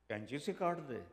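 ordinary delivery

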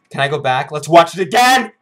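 builds to a high, loud cry; excited demand. Real, not acted. Thrilled, demands a rewatch.

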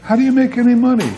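high, fast disbelieving customer; incredulous customer. Retold or imitated. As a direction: blurt it out quickly in disbelief. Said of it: "And they see four people sitting there".

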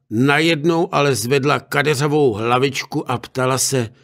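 ordinary delivery

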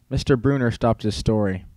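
usual speaking voice

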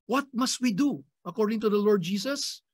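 ordinary talk, nothing marked

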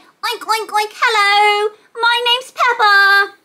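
high cheerful piggy voice with oinks; Peppa Pig voice. Acted. Teacher voicing Peppa toy for toddlers, high and cheerful. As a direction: play the cute pig toy. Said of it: "It's Peppa the pig".

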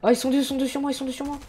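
high, loud, frantic; panicked excitement. Real, not acted. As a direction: yelp it out in a panic. Two enemies attack her in-game.

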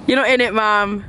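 ordinary talk, nothing marked

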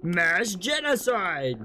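high, slow, stretched-out announcement; triumphant proclamation. Pretend. Player boasting after killing the bugs, proclaiming triumphantly. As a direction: proclaim it high, drawing out each word.

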